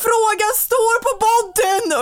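high, slow, loud mock yell; imitated stressed shout. Partner pointing to the question on the bodysuit, imitated shout. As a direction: bellow it drawn out like a frantic partner. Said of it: "han skriker ju så här".

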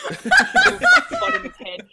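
high, loud and slow, laughing through it; slow laughing squeal. Real, not acted. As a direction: dissolve into laughter on the words. Laughing at ashtray joke.

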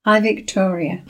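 ordinary delivery

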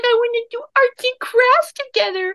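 very high-pitched mock girlfriend voice; exaggerated falsetto mimicry of a girlfriend; Angel impression. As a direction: imitate her in a squeaky-high put-on voice. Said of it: "doing a really good angel voice".

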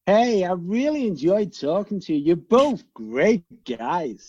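put-on American accent. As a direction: play it like a Happy Days character. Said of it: "chotty out of Happy Days".

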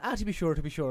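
loud, high-pitched stage Irish; hammed-up mock Irish accent. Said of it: "really stereotypical Irish accent".

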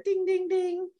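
high playful "ding, ding, ding"; sing-song high ding; mock bell. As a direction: chime it like a quiz-show bell. Mock game-show bell after punchline.

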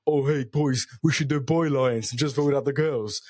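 lightly voiced dim muscle man; a hint of an all-brawn, no-brain bloke; brawny meathead. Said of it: "this one guy who's just all about brawn, no brain".

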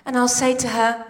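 ordinary talk

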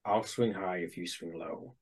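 low, hushed aside to an ally; in-character whisper. Acted. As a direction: murmur it secretly to a comrade. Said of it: "Bowman's gonna whisper".

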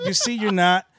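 ordinary delivery

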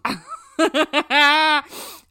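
loud high burst of laughter; big laugh. Real, not acted. Laughs at the jab.

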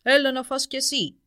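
loud, booming order; commanding dragon. A narrator's reading. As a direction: order him to eat like a giant. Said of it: "είπε επιτακτικά". (επιτακτική φωνή δράκου)

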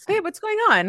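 very high bright hello; squeaky greeting. Acted. Greeting her pregnant co-host, mock chirpy. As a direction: pitch it way up as a cheery hi.

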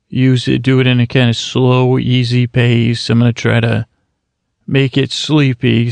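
usual speaking voice

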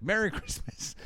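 high, chirpy holiday greeting; mock cheery dodge. Host as the boyfriend dodging her, high mock cheer. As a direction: toss it out high to dodge the question.

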